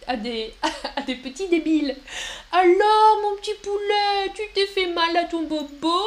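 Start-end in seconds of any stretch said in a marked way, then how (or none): 2.5-6.0 put-on high, loud sing-song baby talk; little voice for kids. Shown as a demonstration. Teacher showing how adults coo at small children. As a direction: talk down to a toddler sweetly. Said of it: "avec une petite voix comme ça".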